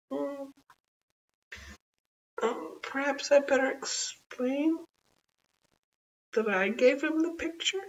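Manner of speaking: put-on a guilty child confessing; sheepish little girl. A narrator's reading. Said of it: "said Taffy".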